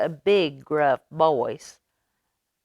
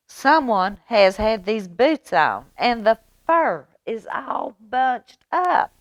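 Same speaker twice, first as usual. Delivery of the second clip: mama bear; 6 semitones higher, 5 dB louder than usual. Acted. Mama Bear finds boots worn.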